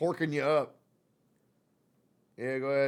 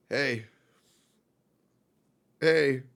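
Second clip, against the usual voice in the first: ordinary delivery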